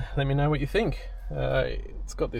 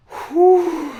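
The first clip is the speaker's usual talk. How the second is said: winded, puffing after effort; out of breath; 8 dB louder than usual. Real, not acted. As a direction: blow out a long breath. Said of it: "let me catch my breath".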